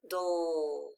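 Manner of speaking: "dull" drawn out low and soft; long "dull" sinking in pitch; isolated final syllable. Shown as a demonstration. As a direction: stretch "dull" as one lone syllable. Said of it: "the final stable syllable".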